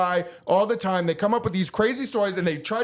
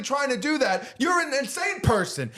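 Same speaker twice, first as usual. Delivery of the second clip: heated rant; 7 semitones higher than usual. Real, not acted. Ranting about surveillance footage.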